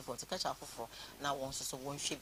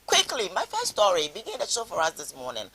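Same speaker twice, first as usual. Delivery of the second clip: bright, loud launch into the first story, pitched up; excited call; 4 semitones higher, 9 dB louder than usual. Real, not acted. Host hyping viewers for the first story, excited and loud. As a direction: punch it out like a host opening the segment.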